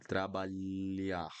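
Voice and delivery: trabalhar slowly, lh like the lli in million; Portuguese lh. Shown as a demonstration. Teacher drills trabalhar slowly, lh like million. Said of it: "you're going to pronounce like this".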